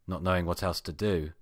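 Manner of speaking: ordinary delivery, nothing marked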